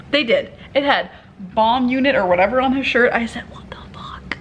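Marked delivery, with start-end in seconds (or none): 3.5-4.4 voiceless, hushed disbelief; much softer, whispered shock; stunned whisper. Retold or imitated. Stunned aside at bomb squad arriving.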